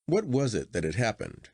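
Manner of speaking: usual speaking voice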